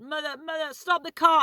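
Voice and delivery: high, urgent mock yell; retold scream. Passenger begging mum to stop, retold scream. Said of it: "I screamed it".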